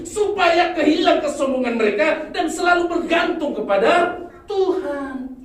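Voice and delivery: loud, high fervent sermon delivery; loud, rousing pulpit voice; full-force preaching. Acted. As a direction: preach it out to the whole congregation.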